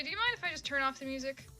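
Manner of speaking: ordinary talk, nothing marked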